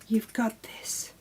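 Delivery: soft unvoiced whisper of encouragement. Crafter whispering encouragement to her pinking shears, whispered. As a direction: breathe "You've got this" to the scissors as a secret cheer. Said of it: "whispered pep talk to shears".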